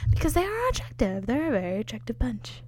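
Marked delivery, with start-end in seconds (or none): none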